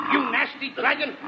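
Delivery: ordinary talk, nothing marked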